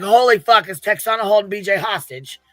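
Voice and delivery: loud, high shocked cry of disbelief; shocked outburst. Retold or imitated. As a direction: blurt it out, stunned and loud. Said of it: "I was like".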